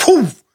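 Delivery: high, loud impact noise; sudden punchy whoof; imitated kick whoosh. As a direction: voice the kick landing.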